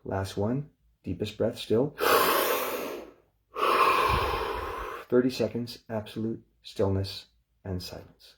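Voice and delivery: calm, measured breathing instructions; guided breathing cue. Acted. Meditation teacher guiding the group, calm and measured. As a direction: talk them into the breath gently.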